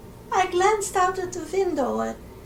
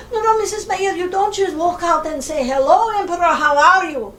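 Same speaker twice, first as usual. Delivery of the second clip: urgent warning; 3 semitones higher, 9 dB louder, faster than usual. Retold or imitated. Assistant urgently stops her.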